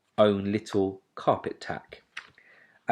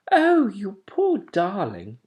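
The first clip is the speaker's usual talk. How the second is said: put-on sweet young voice; 11 semitones higher, 7 dB louder, slower than usual. A narrator's reading. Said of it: "said the sweet young voice".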